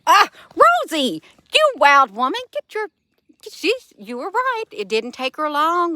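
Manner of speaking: high, loud, excited cooing at the cat; pet talk. Real, not acted. Owner cooing at wild cat.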